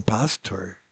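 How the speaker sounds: "pastor" low-key with English first-syllable stress; American pronunciation. Retold or imitated. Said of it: "Ellos dicen pastor".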